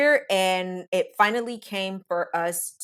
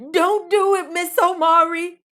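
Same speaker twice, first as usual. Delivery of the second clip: high, loud pleading warning from a stranger; voice from the back; 8 semitones higher, 6 dB louder than usual. Retold or imitated. Someone in the back warning her not to do it, pleading. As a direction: play a worried stranger begging her to stop. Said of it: "I almost heard somebody in the back".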